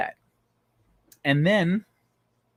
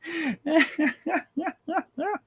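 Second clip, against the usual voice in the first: high burst of laughter; 11 semitones higher, 3 dB quieter than usual. Real, not acted. Laughing at his own farm joke, a big high laugh.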